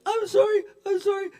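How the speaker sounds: very high, pleading whimper; whimpering apology. Acted. Pinned driver to the cyclist, pathetic begging. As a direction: beg in a squeaky mock panic. Beaten driver begs in fantasy.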